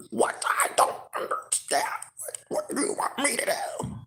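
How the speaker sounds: soft breathy whispered priestess. Retold or imitated. Imitating the priestess refusing to mate, hushed. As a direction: play a confused demure priestess. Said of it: "that's how they talk".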